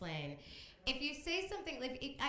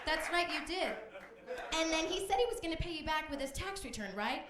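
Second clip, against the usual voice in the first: higher, louder egging-on voice; a touch of a prodding show host; 7 semitones higher, 4 dB louder than usual. Acted. A host egging on her witness, lightly raised and prodding. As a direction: egg her on, a bit raised. Back in the prodding Sharon role.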